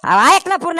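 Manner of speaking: loud, insistent cut-in; loud interruption. Acted. Father interrupting his wife, loud and insistent. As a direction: cut her off hard.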